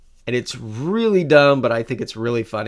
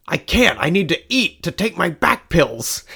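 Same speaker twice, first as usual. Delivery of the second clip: raised, louder put-on complaint; mock protest; 5 semitones higher, 4 dB louder than usual. Dad refuses to wait for Clark, mock protesting. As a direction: imitate a father-in-law's loud, higher-pitched excuse. Retold gruff refusal by the father-in-law.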